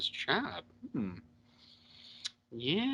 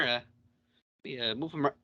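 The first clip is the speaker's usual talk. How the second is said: normal talking voice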